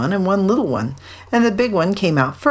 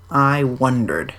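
slow, low donkey voice; Eeyore; 5 semitones lower, slower than usual. A narrator's reading. Eeyore turning round in an eddy, slow and low. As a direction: say it slowly, sinking low. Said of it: "said Eeyore".

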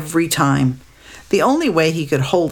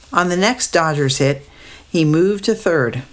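usual speaking voice

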